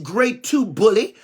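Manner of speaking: ordinary talk, nothing marked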